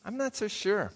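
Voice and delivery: usual speaking voice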